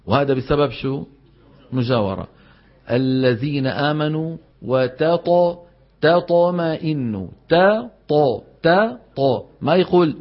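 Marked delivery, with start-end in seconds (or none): 2.9-9.4 "وتطمئن" with the ta made emphatic like ṭa, slow; ta turned to ṭa. Shown as a demonstration. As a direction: thicken the ta before ṭa in "تطمئن". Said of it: "كأنه طائين". (تفخيم التاء بسبب المجاورة)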